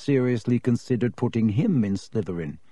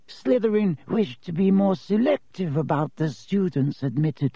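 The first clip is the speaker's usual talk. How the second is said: put-on high, thin, dry old lecturer; dry reedy voice; 7 semitones higher than usual. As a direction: drone it like a dusty ghost professor. Ghost professor lectures class.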